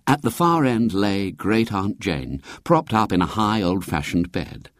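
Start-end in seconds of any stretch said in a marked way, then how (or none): none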